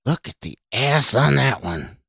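growling, leering unseen voice; rough growl. A narrator's reading. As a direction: growl it like a crude voice in his head. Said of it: "said again with a rough growl".

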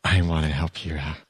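lightly voiced creepy witch, coaxing; creepy Zelena. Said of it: "creepy when she was all like".

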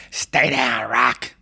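imitating a gruff old trainer; drawn-out impression of a trainer's plea; Burgess Meredith. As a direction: do the actor's cornerman voice. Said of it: "we can't fake Burgess Meredith's voice".

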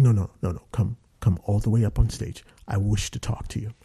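low, formal summons from a director; booming director voice. Retold or imitated. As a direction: imitate a deep-voiced director giving orders. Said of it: "it's like James Earl Jonesy".

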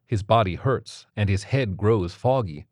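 ordinary delivery, nothing marked

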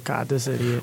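low, soft, exasperated aside; Kevin Owens. Retold or imitated. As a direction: mutter it low, fed up with an idiot. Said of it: "Kevin Owens is like".

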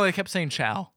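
ordinary talk

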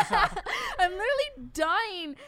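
high, slow, loud through laughter; laughing. Real, not acted. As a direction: laugh as you draw it out. Laughing at own crying story.

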